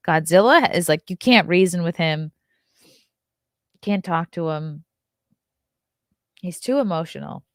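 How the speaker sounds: normal talking voice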